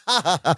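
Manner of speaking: mock laughter, spelt-out ha-ha; imitated laugh. Co-host retelling Larry relieved after the dream, mock laugh. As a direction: fake someone bursting into laughter. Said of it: "he just starts laughing like".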